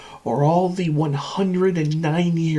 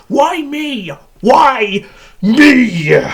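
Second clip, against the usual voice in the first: high, loud mimicked plea; mock anguished wail; 9 semitones higher, 8 dB louder, much slower than usual. Machine mimics tortured captives wondering why, mock anguish. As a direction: wail it out, the second take louder.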